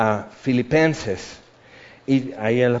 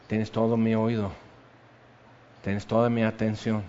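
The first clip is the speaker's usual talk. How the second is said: devoted; 6 semitones lower, 8 dB quieter than usual. Retold or imitated. Mary's devotion at Jesus' feet.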